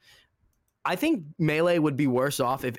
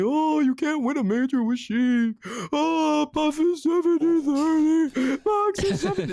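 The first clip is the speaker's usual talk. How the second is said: high, loud, whiny mock voice; mocking whiny player; 13 semitones higher, 4 dB louder than usual. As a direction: mimic the doom-sayers with scorn. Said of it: "Sheik mans are telling me".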